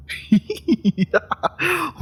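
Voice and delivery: a bright, high burst of laughter; giddy cackle of delight. Acted. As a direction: laugh up high, thrilled to be outplayed. Delighted laugh at being outplayed.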